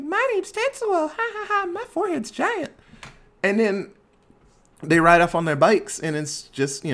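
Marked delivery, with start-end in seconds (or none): 0.0-2.7 put-on very high, squeaky little-kid voice; mock a tiny child in squeaky falsetto; childish high-pitched teasing impression; little kid Tetsuo. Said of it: "he's, like, a little kid".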